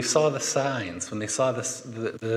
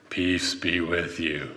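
lower, slow, solemn calming voice; a grave voice that calms scared disciples; deep Jesus-y voice; 7 semitones lower, slower than usual. Retold or imitated. Jesus greeting his frightened disciples, deep and slow. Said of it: "his deep Jesus-y voice".

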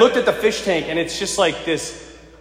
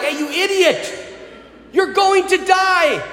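yelling at the fish; 10 semitones higher than usual. Retold or imitated. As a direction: shout it at a dumb fish about to die. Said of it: "I'm yelling".

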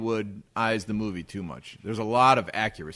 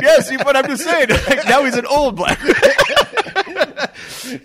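laughing through the words; slow words broken by laughter; 12 semitones higher, 11 dB louder than usual. Real, not acted. Laughing while explaining.

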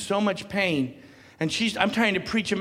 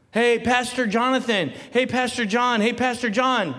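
calling out higher and louder, half-teasing; mock call for the pastor; 6 semitones higher, 4 dB louder than usual. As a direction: play a helpless church member ringing the pastor. Mocks calling the pastor for help.

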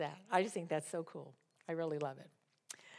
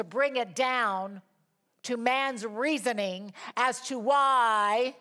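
slow, loud, raised declamation; forceful preaching; 7 semitones higher, 7 dB louder, slower than usual. Real, not acted. Preacher setting scripture against tyrants and churches, forceful declamation. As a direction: hammer each contrast with weight.

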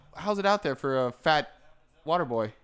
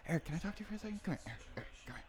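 much softer, murmured summons; hushed aside; 15 dB quieter, much faster than usual. Pretend. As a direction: quietly call a friend over for a private word. Calling Eric aside in hushed voice.

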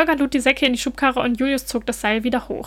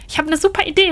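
higher and louder, eager; excited call; 6 semitones higher, 4 dB louder, faster than usual. A narrator's reading. Holger to Julius, eager and excited. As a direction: burst out with the idea. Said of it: "rief Holger aufgeregt".

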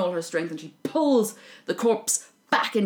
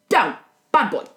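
louder, sharp command; scolding a dog; 3 semitones higher, 9 dB louder than usual. Retold or imitated. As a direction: tell him off like a bad dog.

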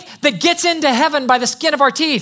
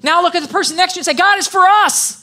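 high, emphatic pulpit declaration; preaching at full force; 5 semitones higher than usual. Real, not acted. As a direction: proclaim each phrase to rouse the congregation.

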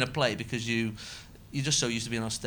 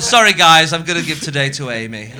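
mock announcement; 4 semitones higher, 16 dB louder than usual. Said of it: "I'm not going".

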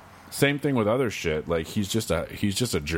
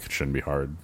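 ordinary delivery

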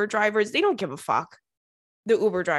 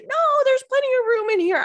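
high, loud, over-eager; insistent; 6 dB louder than usual. Retold or imitated. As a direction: insist brightly. Insisting to the girl.

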